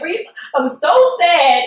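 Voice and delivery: loud, high, drawn-out mock sobbing; exaggerated crybaby complaint; mock tearful wail. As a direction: wail it out, hamming up the sadness. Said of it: "We both started crying".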